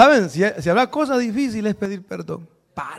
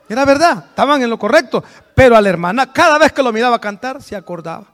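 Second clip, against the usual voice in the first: louder, driving the point home; emphatic preaching; 3 semitones higher, 7 dB louder than usual. Real, not acted. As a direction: press the point with a raised voice. Preacher raising voice to stress. (predicando con fuerza, en voz más alta)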